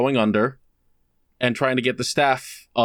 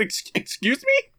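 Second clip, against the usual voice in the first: retold disbelief. Shocked by the landlady news.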